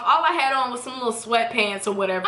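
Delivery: ordinary talk, nothing marked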